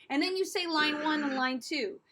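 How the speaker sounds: ordinary talk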